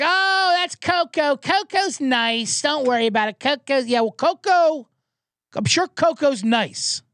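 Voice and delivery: high mock owner voice, drawn out; mock dog owner. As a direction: play a breezy owner brushing it off.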